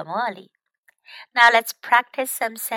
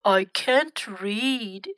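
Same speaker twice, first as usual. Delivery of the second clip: slow, hesitant admission; shy; much slower than usual. Acted. Grandfather admits it to the girls, mildly shy. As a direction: own up to it shyly, drawing it out. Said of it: "he said shyly".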